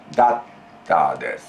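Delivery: "だっ" held, then a pause before "たです", pitch dropping low; "だっ たです" with a one-beat catch on the double t; geminate pause. Shown as a demonstration. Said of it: "first T is simply pause for one syllable".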